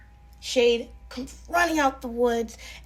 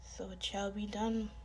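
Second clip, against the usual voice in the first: whispered; 11 dB quieter than usual. A narrator's reading. Shadow whispers after kissing her.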